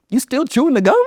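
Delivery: can't believe the gum is still there; retold shocked dad; disbelief. Dad discovers old gum.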